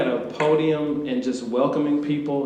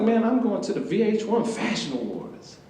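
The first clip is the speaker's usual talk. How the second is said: higher-pitched, retold boast; proud brag; 7 semitones higher than usual. Telling coworkers where he is going, proud brag. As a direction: announce your big plans proudly.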